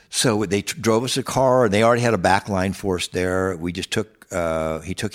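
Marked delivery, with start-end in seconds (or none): none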